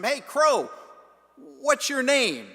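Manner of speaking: loud, high call, the name question slowed; Fred calls after the crow walking away, loud and high. Retold or imitated.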